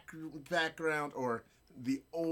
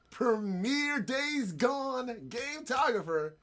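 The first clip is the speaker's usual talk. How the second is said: hype introduction; 8 semitones higher, 4 dB louder than usual. Real, not acted. Host presenting a guest from Twitter, hype announcer introduction.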